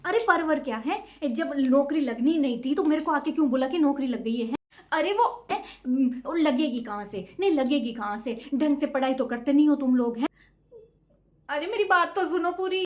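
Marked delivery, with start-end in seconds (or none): none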